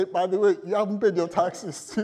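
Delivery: triumphant, hammed-up; mock accusing. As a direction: collectors catching someone out, mock triumph. Collectors after Googling.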